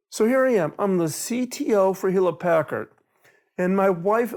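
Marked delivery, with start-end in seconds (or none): none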